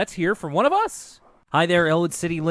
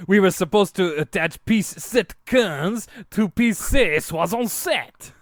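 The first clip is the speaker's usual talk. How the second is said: mock French accent; 4 semitones higher, slower than usual. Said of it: "that's as close as I can get it".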